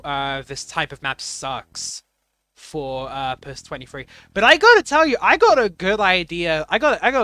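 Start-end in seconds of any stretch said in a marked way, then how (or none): 4.3-7.1 excited. Real, not acted. Excited about a new gimmick idea.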